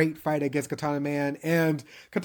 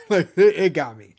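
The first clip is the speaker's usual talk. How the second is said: laughing; 3 semitones higher, 10 dB louder than usual. Real, not acted. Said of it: "I ain't gonna lie".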